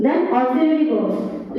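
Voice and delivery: ordinary talk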